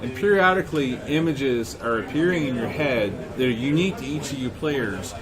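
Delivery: normal talking voice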